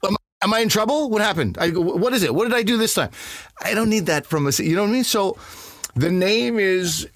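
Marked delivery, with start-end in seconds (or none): 0.1-3.1 mock panic. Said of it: "I'm, I'm like".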